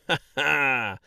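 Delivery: hearty spoken ha-ha; jovial laugh. Acted. Mayor greets the travelers, jolly laugh. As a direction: laugh big and merry. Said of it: "jovial voice boomed".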